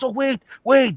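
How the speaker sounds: put-on high, urgent caricatured Chinese accent; mock Chinese accent. Shopkeeper calls back the customer, urgent mock Chinese accent. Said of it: "The Chinese man tells him".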